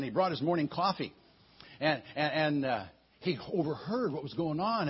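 ordinary talk

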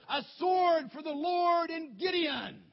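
slow, high, full-voiced shout; battle cry. Gideon teaches his men the signal, shouted battle cry. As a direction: shout it like a rallying war cry. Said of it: "and you say".

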